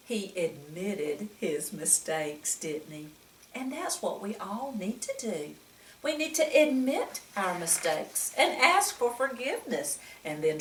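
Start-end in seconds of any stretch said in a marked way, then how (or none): none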